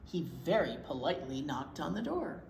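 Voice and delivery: usual speaking voice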